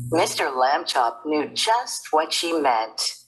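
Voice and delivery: ordinary delivery, nothing marked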